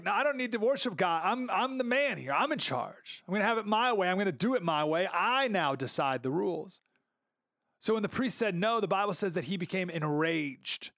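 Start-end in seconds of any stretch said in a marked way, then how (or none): 0.0-6.8 high, pushy mock defiance; arrogant king. King Uzziah defying the priests in the temple, mock arrogant. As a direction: swagger and brush the priests off. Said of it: "But Uzziah's like".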